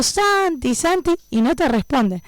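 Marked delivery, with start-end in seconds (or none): 0.0-1.1 much higher, sing-out mock yell at normal volume; imitated repeated calling. Sister still calling her missing brother an hour later, mock yelling. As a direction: imitate calling across the yard. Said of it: "seguimos gritando".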